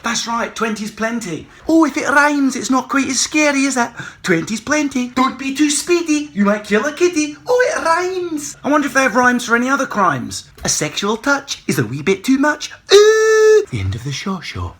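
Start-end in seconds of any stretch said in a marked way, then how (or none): 1.7-3.9 much higher, louder mock glee; mock delight at rhymes; mocking sing-song. Mocking rhyming signs. 4.2-7.4 high, loud nursery-rhyme voice; sing-song rhyme. Pretend. 7.5-8.6 very high, slow cooing delight; mock delight. 12.9-13.6 very high loud squeal. Pretend.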